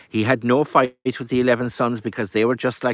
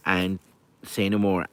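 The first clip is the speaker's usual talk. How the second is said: usual speaking voice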